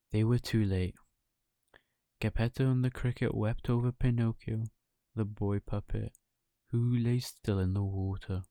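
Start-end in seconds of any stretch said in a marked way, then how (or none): none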